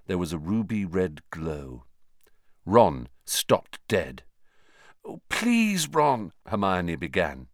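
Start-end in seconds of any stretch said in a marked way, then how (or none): 5.1-6.3 pleading. A narrator's reading. Hermione pleading.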